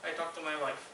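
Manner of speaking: usual speaking voice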